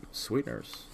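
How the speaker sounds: drawn-out sultry delivery. Real, not acted. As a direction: say it slow and seductive. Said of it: "It was the way you said sweeteners".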